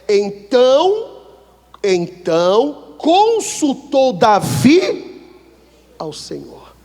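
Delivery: slow, drawn-out pulpit reading of the verse; solemn scripture reading. Acted. Preacher reading the verse to the congregation, slow and solemn. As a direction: read the holy words slowly and grandly.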